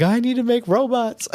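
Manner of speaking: put-on high, eager little-kid voice; a wide-eyed kid who just saw the movie; excited kid voice. Host as a kid after watching Short Circuit, excited child voice. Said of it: "I was like".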